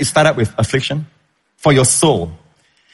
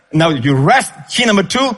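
ordinary delivery

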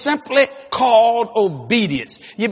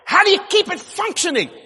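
mock shout; 9 semitones higher, faster than usual. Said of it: "people say".

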